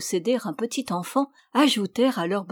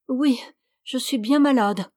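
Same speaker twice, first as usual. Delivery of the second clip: plainte aiguë et hachée de fausse malade; voix haute, brisée, qui joue la souffrance; voix entrecoupée; 5 semitones higher, 3 dB louder than usual. Acted. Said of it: "répondit une voix entrecoupée". (la fausse épouse geint derrière les rideaux, voix entrecoupée)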